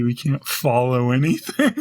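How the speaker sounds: usual speaking voice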